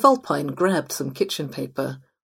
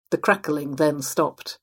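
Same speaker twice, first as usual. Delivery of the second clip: ordinary delivery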